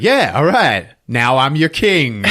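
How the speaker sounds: high, loud mock-triumphant announcement; triumphant boast. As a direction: crow over the win, stretching the claim. Acted victor claiming the throne.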